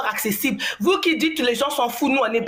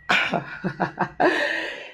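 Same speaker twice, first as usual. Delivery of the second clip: shy laugh; 5 semitones lower than usual. Real, not acted. Shy laugh before speaking English.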